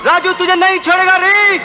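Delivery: defiant threat with climbing pitch; vengeful outburst. Acted. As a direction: swear revenge, building to a high-pitched cry. Enraged vow of revenge in film scene.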